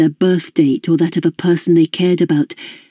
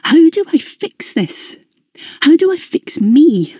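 desperate; 10 semitones higher than usual. A narrator's reading. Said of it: "desperate suddenly to move forward".

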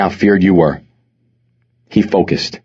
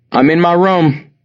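raised pitch, slow call; calling back; 9 semitones higher than usual. A narrator's reading. Mother responding to her son from her room, calling back. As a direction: answer someone from another room.

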